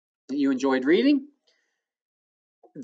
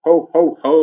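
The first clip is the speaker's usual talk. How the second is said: Santa laugh; 6 dB louder than usual. Retold or imitated. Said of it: "like Santa Claus says".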